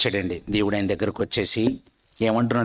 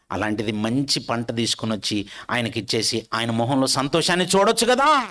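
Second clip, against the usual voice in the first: ordinary talk, nothing marked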